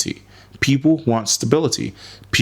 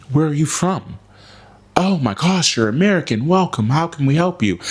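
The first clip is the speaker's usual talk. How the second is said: much higher, eager mock welcome voice; gushing locals; 7 semitones higher than usual. Locals greeting an American tourist, hammed-up gushing. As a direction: imitate locals fawning over an American. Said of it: "they immediately".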